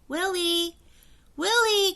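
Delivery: high, loud call across the river. A narrator's reading. Annie calling for Willie, high and loud. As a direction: call out to find him. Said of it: "called Annie".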